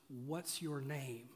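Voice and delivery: low, slow and very soft, gently asking; soft slow question. A narrator's reading. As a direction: ask it slowly and hushed, with weight. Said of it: "the angel of the Lord says".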